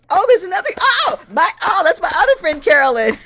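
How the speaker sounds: high, loud laughing greeting; delighted laughing. Real, not acted. Host laughing on spotting her friend Carolyn, real delight. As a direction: laugh out the surprise of seeing her.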